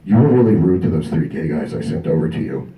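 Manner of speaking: low scolding friend; imitating his friend. Friend scolds the vendor for rudeness, low voice. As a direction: scold him low and calm. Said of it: "comes over and goes".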